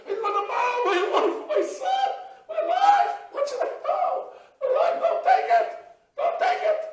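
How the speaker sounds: shrieking high and loud, half-laughing in panic; frantic pleading. Acted. Mother to thief grabbing her son's medicine, frantic high shriek. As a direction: beg him desperately not to take it.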